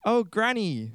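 put-on high girlish voice, falling tune. Girl addresses disguised wolf as Granny, high girlish voice. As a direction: pitch it up as a little girl.

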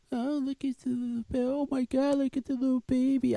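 put-on high, sing-song cooing over a baby; mock stranger cooing. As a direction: gush like a stranger leaning over the stroller. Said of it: "people are, are coming up and I'm".

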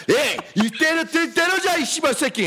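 high mock thug taunt in Korean; mock Korean tough guys. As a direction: ham up the goons talking tough. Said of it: "they came trying to be like".

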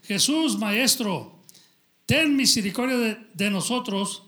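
loud, pleading call to the master; crying out loud. Acted. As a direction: raise your voice and plead with Jesus. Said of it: "alzaron la voz diciendo".

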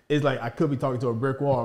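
usual speaking voice

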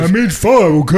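hammed-up Stallone correcting himself; Rocky voice. Continuing Rocky bit.

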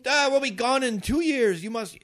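high, loud doomsayer cry; hammed-up politician sounding the alarm; exaggerated alarm. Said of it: "Do they exaggerate it? Do they get more emotional".

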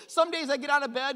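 ordinary talk, nothing marked

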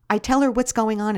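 plain reading voice

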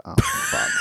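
high, laughing exclamation; laughing dismay. Retold or imitated. Father hearing daughter defend shouting, laughing dismay. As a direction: crack up in horror.

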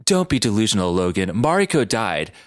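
low, grave rival voice; lightly hammed-up anime mentor; dramatic sensei. A narrator's reading. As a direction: deliver the news low and solemn. Kikyo voiced answering Logan.

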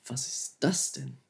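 soft, slow, drawn-out amazement; quiet, slowed wonder; amazed inner thought. Retold or imitated. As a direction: let the awe hang on each word. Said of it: "dachte mir so".

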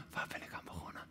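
whispered to himself; "Waar ben ik aan begonnen?" whispered silently to himself. Shown as a demonstration. Preacher contrasting the king's private doubt, whispered. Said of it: "stilletjes bij zichzelf afvraagt".

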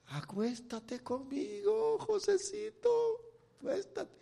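high, soft seductive voice as Potifar's wife; seductive woman. Acted. Acts Potifar's wife seducing José. (voz de mujer seductora, aguda y suave)

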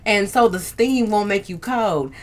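ordinary talk

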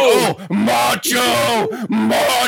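high, drawn-out mock bellow; imitated yelling. Hulk running down the hall after Macho, hammed-up yell. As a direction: holler it slowly like a panicked wrestler. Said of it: "like, you know, yelling Macho down the hall".

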